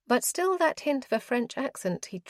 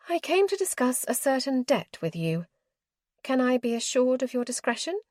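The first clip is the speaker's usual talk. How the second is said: higher, prim lady's voice, businesslike; proper and firm, voiced a bit higher; formal young lady; 6 semitones higher than usual. A narrator's reading.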